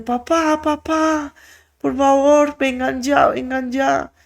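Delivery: high, urgent cry for her father, panicked; high-pitched frightened calling out; frantic call for dad. Retold or imitated. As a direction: shout for papa as if you've just seen something terrible. Girl calls father after finding bodies.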